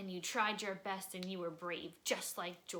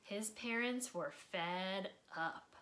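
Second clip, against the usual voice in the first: expressive read-aloud storytelling; lively children's book narrator; story narration; slower than usual. A narrator's reading. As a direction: read it like bedtime story time for kids. Reading a picture book aloud.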